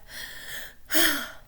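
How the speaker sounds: heavy breathy exhale; out-of-breath sigh. A narrator's reading. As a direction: puff out air as if winded. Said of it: "He was out of breath".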